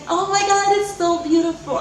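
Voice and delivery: mock squeal of delight at twins news; excited gush. Reacting to doctor's twins news.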